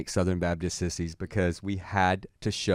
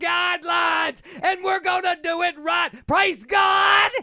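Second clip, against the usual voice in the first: fiery preacher. Retold or imitated. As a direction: preach it at the top of your lungs. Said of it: "crazy sermon".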